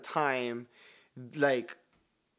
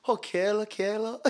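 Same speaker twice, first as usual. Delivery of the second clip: Pidgin repetition; 8 semitones higher than usual. Shown as a demonstration. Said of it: "repeat 'em three, four times".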